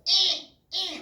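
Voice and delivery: put-on short, high, loud baby whines; imitated baby whining. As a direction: whine like a fussing newborn. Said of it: "찡찡이로 하더라고요".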